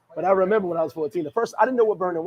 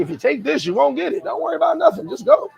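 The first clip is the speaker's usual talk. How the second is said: mock commenter; 8 semitones higher, 4 dB louder than usual. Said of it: "he was like".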